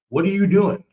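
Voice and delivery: mock angry shout; retold angry yell. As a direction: demand it of him. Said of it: "I went like".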